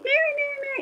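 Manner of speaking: put-on high, sing-song call of a little girl; child calling out. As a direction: mimic a kid yelling for a neighbor across the yard. Child calling her from outside.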